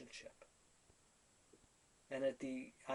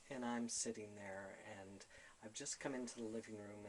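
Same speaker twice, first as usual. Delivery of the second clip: ordinary talk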